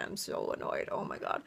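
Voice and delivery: whispered annoyance under the breath; real frustrated whisper to herself; annoyed whisper. Real, not acted. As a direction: hiss it out, fed up.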